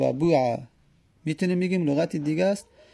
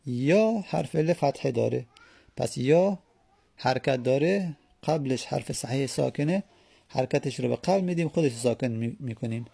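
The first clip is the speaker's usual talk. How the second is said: usual speaking voice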